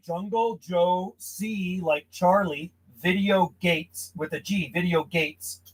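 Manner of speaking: slow and clear, spelling it out; slow dictation. Shown as a demonstration. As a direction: dictate the name word by word. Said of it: "Okay, one more time on your, uh, YouTube channel name".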